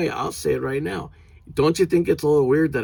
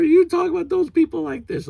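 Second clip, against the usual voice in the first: high whiny mock outrage, much higher; mocking offended person; 5 dB louder than usual. Mocking people who virtue signal, high whiny fake outrage. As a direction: mimic a prissy offended scold. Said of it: "virtue signal all the time getting mad".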